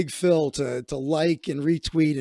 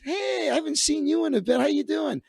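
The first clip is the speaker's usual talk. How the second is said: bright friendly greeting; 9 semitones higher than usual. Retold or imitated. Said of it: "She goes".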